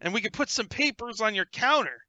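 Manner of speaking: loud eager gnome salesman; excited gnome pitch. Acted. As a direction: pitch the deal like a pushy salesman. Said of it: "Gaxley's like".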